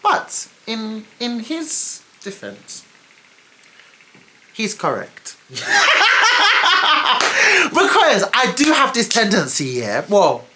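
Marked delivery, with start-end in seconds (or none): 5.5-7.6 high, loud real laugh; sudden high laugh; burst of laughter. Real, not acted. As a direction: laugh out at yourself. Laughing at her own admission.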